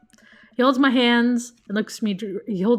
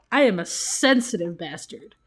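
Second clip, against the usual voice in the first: ordinary talk, nothing marked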